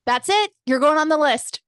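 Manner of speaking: hammed-up threat, pointing at an offender; mock angry yell. Playful mock threat shouting.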